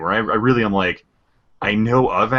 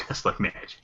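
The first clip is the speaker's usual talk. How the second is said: soft, breathy whisper echo; hushed, voiceless imitation; whispered catchphrase; 9 dB quieter than usual. Echoes a game catchphrase.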